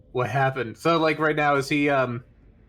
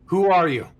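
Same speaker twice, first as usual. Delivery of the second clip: in-character challenge; 4 semitones higher, 5 dB louder than usual. Acted. Questions creature in character.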